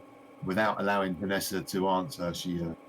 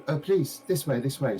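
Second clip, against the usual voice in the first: higher, eager shopkeeper voice; gushing shopkeeper lady; 7 semitones higher, 3 dB louder than usual. Acted. A game master voicing the shopkeeper greeting a visitor, high and eager. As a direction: play the delighted lady greeting her customer.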